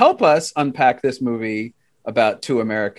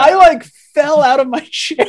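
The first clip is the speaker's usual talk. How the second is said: laughing disbelief; 13 semitones higher, 7 dB louder than usual. Real, not acted. Shocked at forgotten plot twist.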